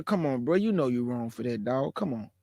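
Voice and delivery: soft, low, easy-going correction; gently telling a brother he's wrong; gentle calm. Shown as a demonstration. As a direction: talk him down softly like a friend. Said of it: "it's not what you say, it's how you said it".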